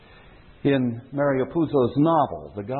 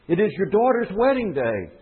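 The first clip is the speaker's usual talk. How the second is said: insistent pleading; 7 semitones higher than usual. A narrator's reading. Dying man presses the Don.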